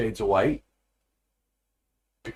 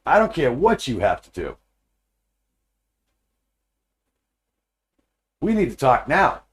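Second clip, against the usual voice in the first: raised and higher, refusing to wait; insistent demand; 5 semitones higher, 6 dB louder than usual. Retold or imitated. Wife refusing to let him leave, loud and insistent. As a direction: push through every excuse.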